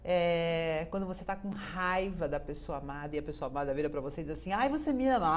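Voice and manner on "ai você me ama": high, louder cooing question; a partner fishing for love; sweet partner question. Pretend. Pessoa amada pergunta. (pergunta melosa da pessoa amada)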